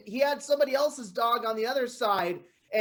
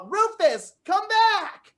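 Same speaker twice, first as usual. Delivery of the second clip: loud call, pitched up; calling the dog; 6 semitones higher, 4 dB louder, slower than usual. Acted. Owner calling her dog back across the park, loud and high.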